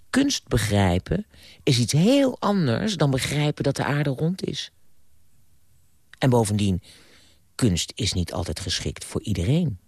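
normal talking voice

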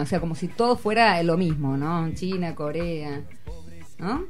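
ordinary talk

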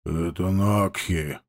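low, slow, quiet reply. Acted. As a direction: say it softly. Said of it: "тихо ответил он".

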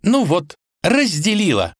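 high-pitched pleased princess; bright-eyed high exclamation; triumphant princess. A narrator's reading. Princess announces she has done it.

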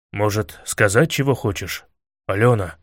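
low, tentative, faintly hopeful question. A narrator's reading. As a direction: ask gently and low, hoping for an answer. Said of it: "с надеждой спросил".

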